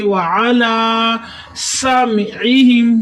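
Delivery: وعلا سمعہم slow, whistling seen and throaty ain; drawn-out وعلا سمعہم, ain from the throat; whistled seen. Shown as a demonstration. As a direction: recite وعلا سمعہم with long laa and sibilant s. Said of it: "سین کو سیٹی کی آواز دیتے ہوئے".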